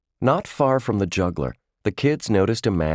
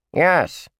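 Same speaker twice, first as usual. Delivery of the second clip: high bratty puppet yes; squeaky dummy insisting; ventriloquist dummy; 10 semitones higher, 3 dB louder than usual. A narrator's reading. Dummy arguing with the man.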